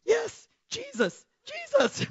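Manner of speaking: high, slow panicked cry; mock panic. Retelling boyfriend joining her prayer, mock panic. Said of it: "with panic in his face".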